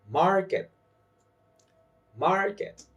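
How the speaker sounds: "market" with a high MAR; stress on first syllable. Shown as a demonstration. Teacher saying words for learners to repeat, first syllable stressed. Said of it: "I will say the word and you repeat".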